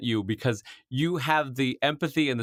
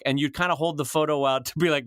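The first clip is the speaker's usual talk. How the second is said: usual speaking voice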